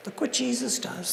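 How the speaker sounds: ordinary delivery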